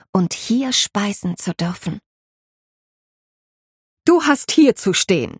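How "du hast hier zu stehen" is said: firm, raised command from the housekeeper; stern tone. Acted. Housekeeper shows the maid her place, stern. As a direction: plant her on the spot, strict. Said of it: "in strengem Tonfall".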